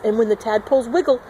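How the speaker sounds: ordinary delivery, nothing marked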